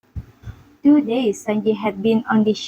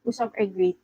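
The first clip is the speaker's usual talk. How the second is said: ordinary delivery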